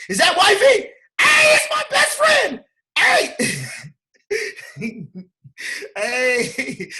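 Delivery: high, loud excited greeting; calling out gleefully to his wife; excited shout. Real, not acted. Greeting his wife on the call, loud and delighted.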